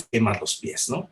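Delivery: usual speaking voice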